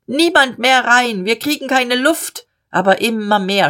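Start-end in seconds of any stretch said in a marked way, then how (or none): 0.1-2.5 high, agitated protest; loud clamouring. Acted. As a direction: clamour over the crush. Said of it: "laut zetert".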